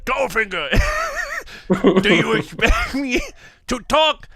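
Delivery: bootleg film-spy impression, breaking into laughs; Sean Connery. Co-host retrying the Goldfinger scene, bootleg Connery voice. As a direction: play the trapped spy, badly faked and amused. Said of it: "That's my bootleg Sean Connery voice".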